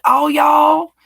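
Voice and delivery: high, loud teasing "y'all"; mocking imitation. People teasing her for saying y'all, mocking imitation. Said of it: "Everybody makes fun of me".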